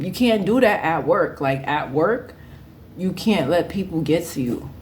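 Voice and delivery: usual speaking voice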